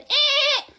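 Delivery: high mock squeal; imitating his girly noise, high and sharp; girly yelp. Mocking boyfriend's girly yelp, high. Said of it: "remember him going".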